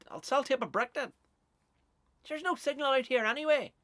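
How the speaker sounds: loud, high-pitched acted shouting; calling out across water. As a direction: yell it to someone far out on the lough. Shouted exchange across the lough.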